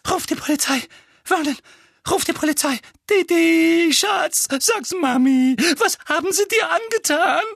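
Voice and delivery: high panicked shriek; shrieking mother. A narrator's reading. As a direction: shriek for the police. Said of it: "Tante Petunia kreischte".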